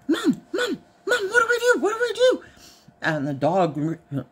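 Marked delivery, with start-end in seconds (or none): 0.1-2.4 put-on high, whiny childlike pleading; like a little kid. Wife imitates husband acting like little kid, high whiny. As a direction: call Mom like a needy child. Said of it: "like a little kid".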